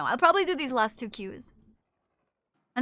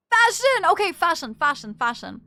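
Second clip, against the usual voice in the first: playful callout; 5 semitones higher, 9 dB louder than usual. Real, not acted. Calling out a catchphrase.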